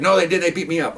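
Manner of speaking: high-pitched imitated tattling; mock complaining suspect. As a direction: play the suspect telling on the officers. Retold suspect complaining to supervisor.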